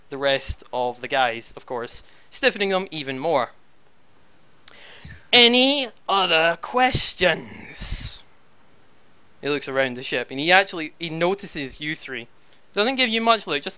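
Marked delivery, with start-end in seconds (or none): 5.3-8.2 menacing commissar. Acted. Commissar after the execution.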